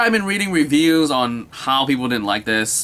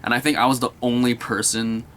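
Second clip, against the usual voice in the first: normal talking voice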